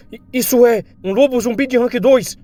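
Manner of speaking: high, stammered exclamation of horror; shocked cry. Acted. Onlooker seeing a zombie wolf attack, shocked yell. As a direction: blurt it out in alarm, pitch way up.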